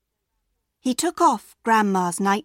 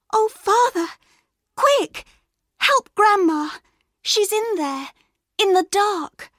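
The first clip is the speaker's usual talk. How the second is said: put-on much higher, urgent little girl; 11 semitones higher than usual. A narrator's reading. Girl begging her father to save grandma, high and urgent. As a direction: cry out like a freed child.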